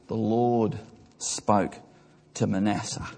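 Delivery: slow, low and sorrowful; sorrowful rereading. Real, not acted. Preacher rereading a verse stained in divine tears, sorrowful. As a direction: let each phrase sink in, heavily.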